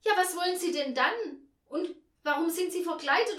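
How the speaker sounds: loud, high agitated questioning; appalled. A narrator's reading. Hostess questioning the stranger in costume, appalled and loud. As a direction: demand answers in shrill dismay. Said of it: "sagte sie entsetzt".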